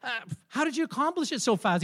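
high-pitched, astonished questioning; amazed. Acted. Father to his son over the finished puzzle, amazed. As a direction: ask it in wonder, voice lifted. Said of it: "He was amazed at his son".

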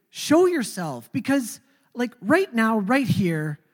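retold shouting at God, high and strained; angry outburst at God. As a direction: demand answers from God, slowed and heated. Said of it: "out loud having an argument with God".

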